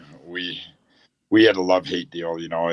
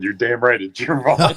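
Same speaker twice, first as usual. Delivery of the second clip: laughing retort; 5 semitones higher, 6 dB louder than usual. Retold or imitated. Retorting to apologizing friend.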